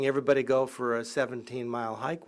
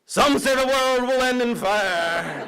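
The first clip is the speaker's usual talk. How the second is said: much higher, louder, slow dramatic reading; hammed-up imitation of Frost reciting his poem; grand recitation; 10 semitones higher, 7 dB louder, slower than usual. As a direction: declaim it like a grand old poet.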